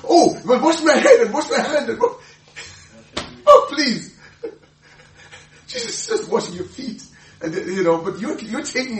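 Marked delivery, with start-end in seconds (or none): 0.0-4.2 high, loud, laughing overeager voice; laughing mock eagerness. Peter begging to be washed all over, laughing high gush. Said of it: "and Jesus said". 4.4-7.0 laughing. Real, not acted. Preacher laughing at Peter.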